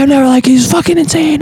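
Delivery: high, loud mock panic; freaking out. Imitating the dude complaining to Miyagi, hammed-up freak-out. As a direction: mimic someone shrieking in disbelief. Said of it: "freaking out".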